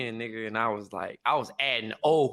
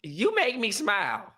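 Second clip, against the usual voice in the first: slow, higher-pitched, lightly voiced lady; lady's voice; 7 semitones higher, slower than usual. Retelling lady who stared at him, higher and slow. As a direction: lightly imitate a charmed lady, unhurried.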